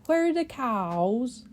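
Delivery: slow, put-on old-fashioned voice, rising in puzzlement; drawn-out bewildered question from a woman out of her century; 1600s woman impression. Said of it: "that's my impression of what she would sound like".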